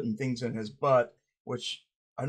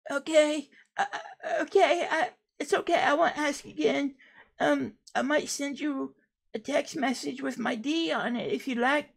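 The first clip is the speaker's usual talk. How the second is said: much higher voice, laughing off the rejection; Sweetie character voice; 13 semitones higher than usual. Acted. Rejected Sweetie answering the interviewer in a high, giggly voice. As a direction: brush it off with a nervous giggle.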